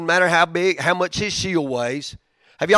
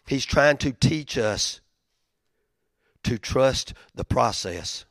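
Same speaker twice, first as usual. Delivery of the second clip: slow emphasis; slower than usual. Real, not acted. Slowed repeat for emphasis.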